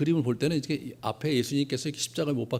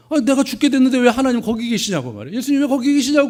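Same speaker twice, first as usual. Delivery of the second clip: high, loud accusing cry; loud complaint; 10 semitones higher, 10 dB louder than usual. Retold or imitated. Pastor voicing his old complaint to God, loud accusing cry. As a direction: hurl the complaint upward.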